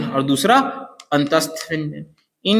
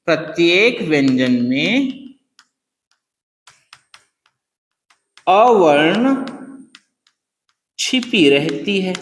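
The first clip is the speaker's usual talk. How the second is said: slow, lower, word by word; slow dictation; 4 semitones lower, slower than usual. Shown as a demonstration. Teacher dictating the line for students to write, slowly. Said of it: "हमको ऐसे लिख दिया".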